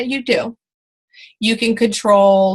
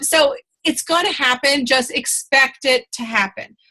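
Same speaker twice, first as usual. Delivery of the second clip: ordinary talk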